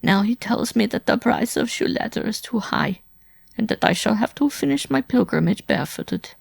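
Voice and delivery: high-pitched woman's complaint, hoarse from weeping; hoarse lament. A narrator's reading. Wife complaining to Simon of her forced pilgrimage, hoarsely. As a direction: pour out the grievance in a high, strained woman's voice. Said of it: "she continued hoarsely".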